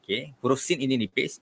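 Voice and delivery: ordinary talk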